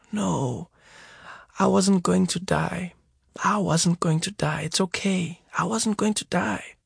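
calm him quietly; lightly voiced injured mother soothing her son; reassuring mother. A narrator's reading. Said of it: "I kept bawling".

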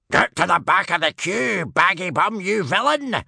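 very high, loud scolding; roar. A narrator's reading. Rival warrior orders Baggy Bum back, roaring. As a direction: roar at a queue-jumper. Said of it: "roared Tough Nut Senior".

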